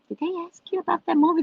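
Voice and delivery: high, rushed query; quick high-pitched confiding question; retold gossipy question. Retold question to other moms.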